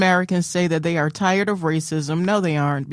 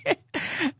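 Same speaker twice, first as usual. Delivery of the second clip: soft high giggle; high laugh; 10 semitones higher, 7 dB quieter than usual. Real, not acted. Laughing retelling the story.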